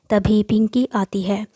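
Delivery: ordinary delivery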